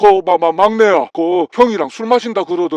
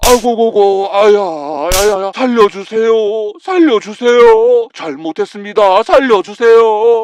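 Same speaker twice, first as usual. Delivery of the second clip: crying out in pain; 3 dB louder than usual. Acted. Father being beaten by daughter-in-law, wailing for mercy. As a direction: plead desperately between the blows.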